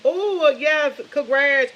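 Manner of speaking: high, loud burst of congratulations; delighted congratulations. Real, not acted. Congratulating someone on their shot.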